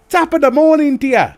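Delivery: loud, high, hammed-up Irish greeting; cheery, badly faked Irish; bad Irish accent. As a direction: have a poor go at an Irish accent. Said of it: "I'm shit with the Irish accent".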